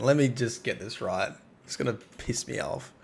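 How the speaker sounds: ordinary talk, nothing marked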